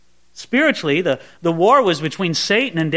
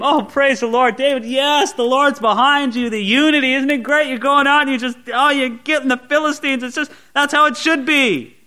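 mock cheering; 5 semitones higher than usual. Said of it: "cheering David on".

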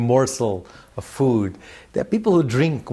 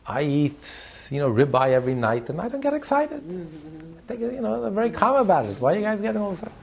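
lightly voiced smug rich man, pitched higher; 7 semitones higher than usual. Wealthy donor scolding the yeshiva boys, mildly smug and higher. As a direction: play a mildly superior wealthy donor, voice raised high. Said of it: "he says".

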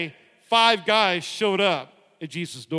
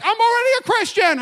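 high, louder squeal of a worried man; paralyzed man's protest; 13 semitones higher, 5 dB louder than usual. Acted. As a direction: pitch it way up like a nervous man. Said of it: "The guy's like".

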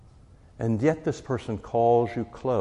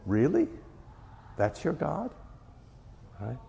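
mocking disbelief; 6 semitones higher, 5 dB quieter than usual. Mocking idol worshippers.

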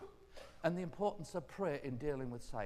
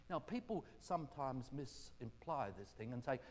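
ordinary talk, nothing marked

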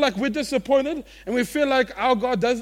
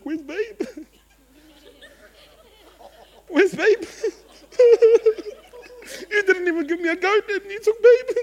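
high laughing whine, breaking into laughs; laughing quote; 11 semitones higher, 8 dB louder than usual. Retold or imitated. Older brother missing his calf, high and laughing. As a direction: laugh through the brother's complaint about Babe. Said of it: "he's like".

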